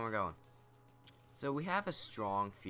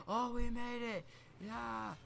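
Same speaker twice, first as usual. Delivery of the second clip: much higher, excited whoops of victory; retold cheering; 14 semitones higher than usual. Friends on bikes spot the town, cheering excitedly. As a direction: cheer like you just reached the finish line. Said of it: "we're cheering".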